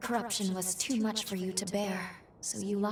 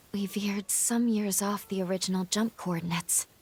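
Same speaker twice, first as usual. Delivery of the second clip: normal talking voice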